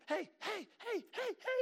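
very high, repeated calls; comic high-pitched pestering; calling for attention. Retold or imitated. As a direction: imitate a husband trying to get his wife's attention. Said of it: "couldn't even get her attention".

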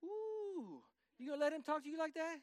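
high, quick sassy egging-on voice; mock instigator, high and fast; instigating girl. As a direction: stir the fight up like a nosy girl in the back row. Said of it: "and she goes".

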